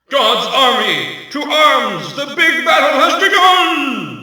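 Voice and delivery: rising shout to arms; rally the troops, shouting; battle cry. Acted.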